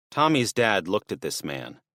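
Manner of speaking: ordinary delivery, nothing marked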